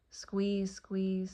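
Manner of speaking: ordinary talk, nothing marked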